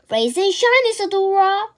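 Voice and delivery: high, loud, chirpy morning call; cheerful wake-up. Acted. Mirabel waking her sleepy friend, cheerful and loud. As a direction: sing out a bright good morning.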